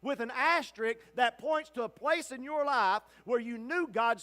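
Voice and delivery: ordinary talk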